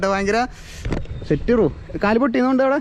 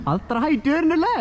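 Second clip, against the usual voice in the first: surprised; 11 semitones higher than usual. Real, not acted. Reacting to jump height.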